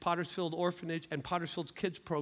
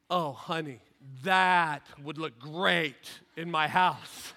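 smitten onlooker; 6 dB louder, much slower than usual. Acted. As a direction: linger on it as if coveting the pot. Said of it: "one or two of you right now going".

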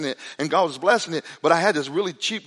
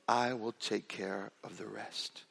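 slow, hushed whisper, much softer; 8 semitones lower, 14 dB quieter, much slower than usual. Retold or imitated. Churchgoer whispering his offer to pay, slow whisper. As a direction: breathe it like a secret promise. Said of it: "he whispered in my ear".

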